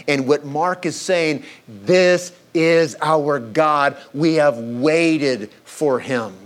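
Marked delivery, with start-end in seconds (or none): 1.7-6.3 slow emphatic declaration. Acted. Preacher drives home Mark's point.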